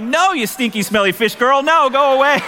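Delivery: high, playful shooing; mock shoo. Dad shooing the fish girl, high playful disgust. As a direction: push her off in mock disgust.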